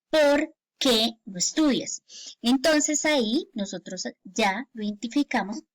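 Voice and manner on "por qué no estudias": POR... QUÉ as two slow words; slow question with "por qué" split; separated words. Shown as a demonstration. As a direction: leave a gap between "por" and "qué". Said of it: "haya ese espacio y suene correctamente".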